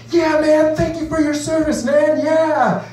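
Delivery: mock concert dude. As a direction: yell thanks over the music. Concert guy shouting thanks.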